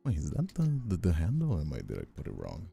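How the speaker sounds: normal talking voice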